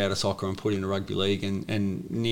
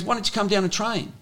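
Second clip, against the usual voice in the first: coach inviting; 11 semitones higher than usual. Retold or imitated. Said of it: "And he goes".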